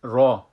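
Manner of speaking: را said loud and full with rounded mouth; full heavy ra. Shown as a demonstration.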